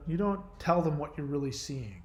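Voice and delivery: normal talking voice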